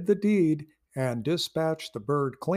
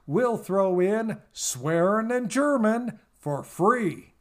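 folksy, booming radio ad pitch; ad announcer; 4 semitones higher than usual. Acted. Announcer pitching a language course to cowboys, booming ad read. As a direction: sell it like a late-night cowboy commercial.

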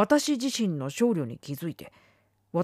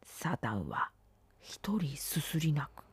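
hushed, much softer and lower; low murmur; 4 semitones lower, 9 dB quieter, slower than usual. A narrator's reading. Narrator muttering that Satan weeps alone, in a low murmur. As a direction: murmur it slowly, almost to yourself. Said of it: "小声でつぶやくことには".

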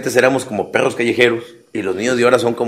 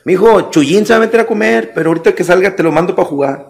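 neighbor lady; 7 semitones higher than usual. Retold or imitated. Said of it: "Una vecina te decía".

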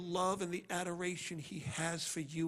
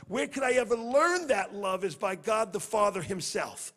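preaching at full force; 6 semitones higher, 9 dB louder than usual. Real, not acted. Preacher raises voice.

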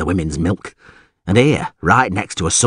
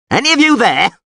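loud, high-pitched holler; calling out; 5 dB louder than usual. Acted. Alderman seeking any of his men, calling out. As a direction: shout into the next room for anyone.

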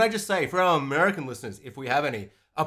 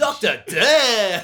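ominous title voice; 6 semitones higher, 6 dB louder, much slower than usual. Pretend. Dramatic show-title voice.

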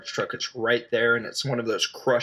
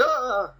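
short, high mock yelp of fright; startled yelp; 12 semitones higher than usual. Reporter spotting a dog in the car, mock startled yelp. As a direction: jump back with a high little yelp.

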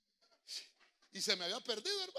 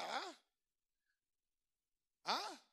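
ordinary talk, nothing marked

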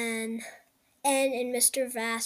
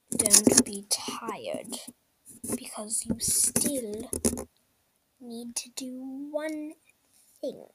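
whispering. Acted. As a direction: hush it like a secret. A voice tells Zem to eat.

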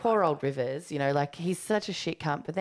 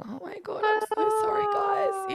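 mock squeaky apology, much higher; Abby Tucker; 3 dB louder than usual. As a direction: gush the apology in a high fake-sorry voice. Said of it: "Poor Abby Tucker's out there going".

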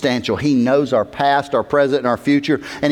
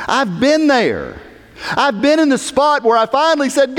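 high, forceful pulpit delivery; impassioned testimony; 13 semitones higher, 3 dB louder than usual. Real, not acted. Preacher confessing he has been there, impassioned. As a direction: testify with climbing intensity.

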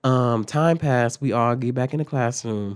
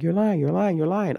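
higher-pitched, repetitive mock accusing; mimicked accusation; 6 semitones higher than usual. Assistant principal disbelieving the student, mimicked accusation. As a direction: chant it at them, mimicking an accuser.

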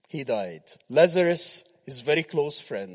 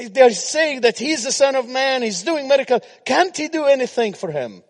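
very high, very loud sermon delivery; preaching; 11 semitones higher, 12 dB louder than usual. Acted. Preacher acting out the religious onlookers at the tomb, full-force preaching. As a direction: call it out like an agitated crowd, pitched way up.

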